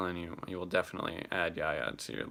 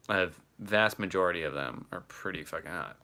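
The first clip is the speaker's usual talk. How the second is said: ordinary talk, nothing marked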